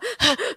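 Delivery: gasping sob, mimicked; imitated hyperventilating cry. Storyteller showing friends how the girl cried, imitated gasping sobs. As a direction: imitate a kid crying so hard they gulp for air. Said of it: "like that kind of crying".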